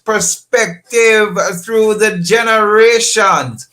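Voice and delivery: high, loud, drawn-out announcer voice; big booming station opener, words stretched; radio show intro. Acted.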